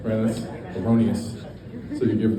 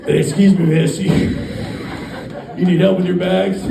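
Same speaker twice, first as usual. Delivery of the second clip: a hint of a too-polite creep, louder and laughing; lightly voiced creep; 5 semitones higher, 4 dB louder than usual. Trafficker sweet-talking a woman in the parking lot, mock polite. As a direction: fake-friendly call to a stranger. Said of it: "and he's like".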